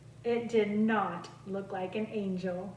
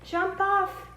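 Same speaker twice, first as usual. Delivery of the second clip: yelled warning; 9 semitones higher than usual. A narrator's reading. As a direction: shout a warning to the kids. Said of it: "yelled Joan and Bob".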